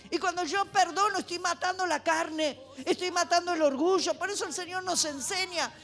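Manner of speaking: loud, impassioned sermon; fervent preaching. Acted. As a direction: preach with rising fire. Preaches at full force.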